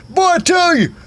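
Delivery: hammed-up high daddy voice; father voice. Comic voicing of father in story.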